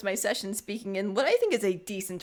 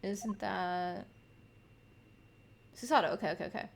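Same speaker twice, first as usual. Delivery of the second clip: ordinary talk, nothing marked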